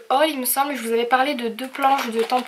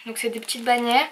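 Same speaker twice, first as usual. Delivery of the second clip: ordinary delivery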